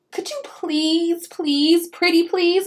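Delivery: high, slow wheedling please; begging. Acted. Pinkalicious begs the elf for sweets, high wheedling. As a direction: beg like a child wanting candy.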